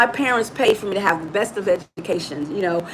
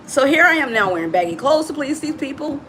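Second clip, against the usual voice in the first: normal talking voice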